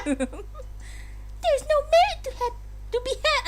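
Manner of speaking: very high haughty princess voice; evil Peach voice. Acted. Streamer voicing the princess's ruling in an evil high voice. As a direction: sneer it out high as evil royalty. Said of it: "Evil Peach".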